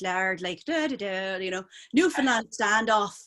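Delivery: Newfoundland-style East Coaster accent; quick sample of East Coast Canadian accent. Shown as a demonstration. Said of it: "like our East Coaster accent".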